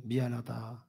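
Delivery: very soft, head-bowed sorry; ashamed apology. A narrator's reading. Old father apologising to his son, softly ashamed. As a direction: barely say it, crushed. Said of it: "아버지가 머리를 푹 숙이면서".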